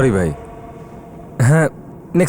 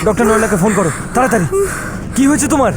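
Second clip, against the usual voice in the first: panicked, worried; 14 semitones higher than usual. Acted. Panicked call for doctor.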